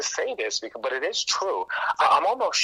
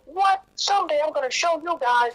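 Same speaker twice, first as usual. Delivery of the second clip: put-on much higher, indignant teen outburst; young self voice; 4 dB louder than usual. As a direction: squeak it out like a snubbed kid vowing revenge. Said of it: "I like that young [name] voice".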